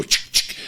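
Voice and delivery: whispered chick chick click; mock briefcase latch sound; briefcase click noise. Imitating briefcase latches opening.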